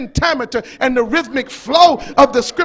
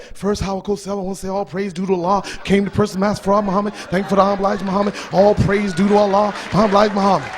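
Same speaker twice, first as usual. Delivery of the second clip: calm Ali; 5 semitones lower, faster than usual. Retold or imitated. Preacher voicing Ali calmly giving thanks to reporters. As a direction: answer the reporter humbly, unhurried.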